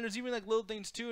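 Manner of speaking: normal talking voice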